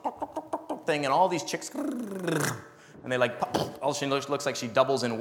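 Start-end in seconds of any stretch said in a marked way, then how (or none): 0.0-0.9 much higher, chirpy hen cluck; playful imitation of a clucking hen. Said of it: "they just do a funny cluck".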